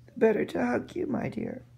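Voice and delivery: high, sweet fake-granny reply; a lightly voiced false old woman, soothing; wolf playing grandmother. Wolf in the bed soothes the girl in a high granny voice.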